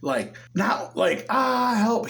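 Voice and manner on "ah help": a light retold cry of fear, higher than usual; hammed-up little scared yelp; mock yell for help. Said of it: "And he starts yelling".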